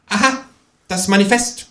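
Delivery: high, knowing kangaroo; high-pitched self-satisfied remark; precocious know-it-all. A narrator's reading. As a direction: state it like a smart aleck. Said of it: "konstatiert das Känguru altklug".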